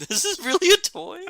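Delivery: can't believe it's a toy; incredulous. Retold or imitated. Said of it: "I was like".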